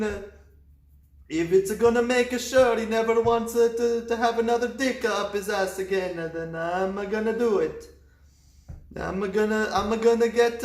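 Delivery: high, loud, hammed-up priest; over-the-top priest monologue; scheming priest voice. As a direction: relish the plan like a cartoon priest. Priest voice plotting.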